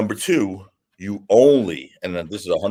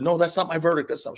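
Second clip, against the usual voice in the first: mildly imitated scared juror, higher pitch; horrified juror; 10 semitones higher than usual. Scared juror disowning her verdict, lightly acted horror. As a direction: retell a frightened protest, voice raised in pitch. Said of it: "one of the jurors was so horrified".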